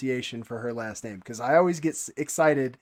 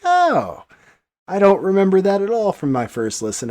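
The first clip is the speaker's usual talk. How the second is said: high, loud burst of delight; happy surprise; 6 semitones higher, 6 dB louder than usual. Retold or imitated. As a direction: relive a delighted rediscovery out loud. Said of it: "so just happy to hear".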